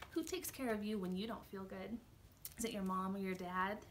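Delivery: ordinary delivery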